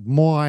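put-on "moi" for "my", rhyming with boy; "moi" with an "oy" vowel; own natural pronunciation. Shown as a demonstration. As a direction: round "my" into "moi". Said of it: "So in my head, when I'm talking to myself".

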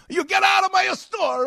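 high, loud furious yell; angry shout. Retold or imitated. Grandfather yells at the man flirting with grandmother, furious shout. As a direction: chase him out with a roar.

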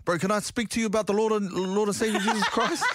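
high mock evangelist pitch; imitating a street preacher. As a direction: mimic an earnest approach, breaking into laughter. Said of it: "And then he goes".

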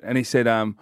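ordinary delivery

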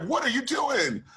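high-pitched imitation of a baffled producer; mock exasperation. Producer reacts to second take.

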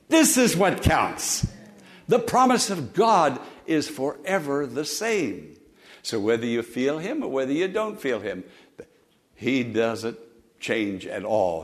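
ordinary delivery

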